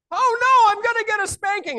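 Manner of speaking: mock a little boy melting down; whiny child. Son answering his parent's warning, whiny child voice. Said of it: "your son says".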